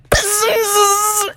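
long high buzzing bzzz; bee buzz. A narrator's reading. Bees coming up behind the players, buzzing. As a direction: imitate bees closing in. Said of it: "the bees were coming".

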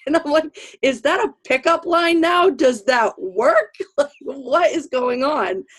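laughing disbelief. Retold or imitated. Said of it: "I busted out laughing in his face".

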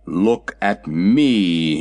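slow, drawn-out indignant call; indignant cry. A narrator's reading. Cab horse Jim protesting to the Sawhorse, slow indignant cry. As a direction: demand to be looked at, offended. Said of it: "he cried".

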